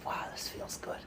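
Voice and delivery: hushed inner thought; whisper. Pretend. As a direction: breathe it to yourself.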